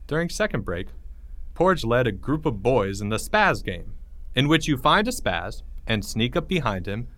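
ordinary delivery

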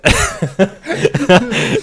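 high, loud burst of laughter. Real, not acted. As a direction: crack up at your own joke. Laughing at his own punchline.

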